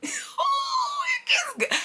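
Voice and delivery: high, breathy squeal of delight; can't contain the thrill; laughing, whispered gush of excitement; giddy squeal. Real, not acted. Thrilled by upcoming story.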